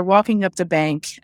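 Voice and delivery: ordinary talk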